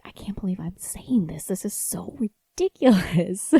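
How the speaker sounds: whispered aside, half laughing at herself; incredulous whisper. Retold or imitated. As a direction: murmur it to yourself in disbelief. Aside while scolding her son.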